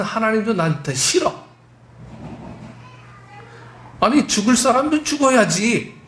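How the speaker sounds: retold resentment, rising to exasperation; acted stubborn refusal. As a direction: refuse like a petulant, resentful prophet. Acts out Jonah's angry refusal to forgive.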